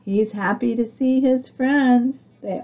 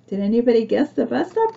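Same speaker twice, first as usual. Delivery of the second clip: plain reading voice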